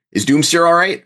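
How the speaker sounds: calling out. Acted. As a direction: call out urgently to the camera. Calling to camera while running.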